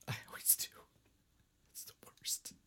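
unvoiced whispered aside; whispering. Real, not acted. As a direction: whisper it under your breath. Whispered reply.

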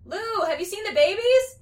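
high, loud urgent question; urgent calling out. A narrator's reading. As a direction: call out as a frantic searching mother. Dee Dee searching for missing babies.

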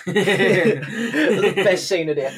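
웃음을 참지 못하며 천천히 말하기; laughing. Real, not acted. Laughing at the line.

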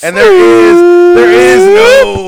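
long, high, loud victory cry; triumphant yell. Real, not acted. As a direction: drag it out like a champion's howl. Triumphant after opening bottle.

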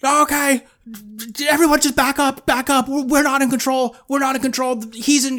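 high, urgent detective imitation; hammed-up alarmed movie cop; Morgan Freeman. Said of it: "Morgan Freeman's like".

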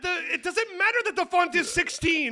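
high, loud, hurried protest; mock defensive shout. Author argues with a skeptic about font size, mock defensive shout. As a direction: play the touchy author, hammed-up. Acted quarrel over book size.

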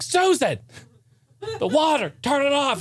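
high pitched pretend yell; hammed-up shriek across the yard; yelling to Susan in front of the neighbors, high mock yell. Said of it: "And I'll just be like".

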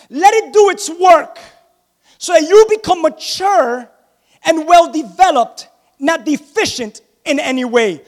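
slow, punched scripture reading to a congregation; preaching. Acted. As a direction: preach it out phrase by phrase.

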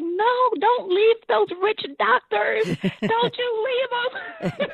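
hammed-up pleading grandmother, drawn out. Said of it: "She was like".

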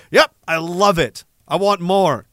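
ordinary talk

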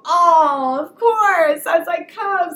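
high, loud, gushing mom voice; touched and tender. Retold or imitated. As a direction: melt at your child's hug. Mother melts at son's hug.

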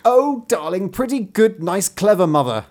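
high, loud flattering plea; insistent high child's voice; begging child. A narrator's reading. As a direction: pile on the praise like a pleading bairn. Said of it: "the one more prettily than the other".

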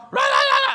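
high loud barks; dogs barking. Retold or imitated. Two dogs barking wildly at the door. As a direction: bark like frantic dogs. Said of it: "I got two dogs".

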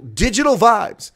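drawn-out booming headline; slow loud proclamation. Acted. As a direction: stretch it out and boom it. Repeats scandal name dramatically.

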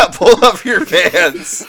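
laughing through the words; giggling as he says it. Real, not acted. Laughing while repeating.

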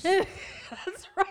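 laughing while agreeing. Real, not acted. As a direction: say it through a laugh. Laughs at fifty hours.

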